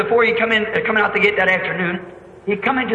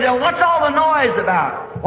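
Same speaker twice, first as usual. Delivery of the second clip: puzzled calling; 7 semitones higher than usual. Retold or imitated. Blind man repeats his question.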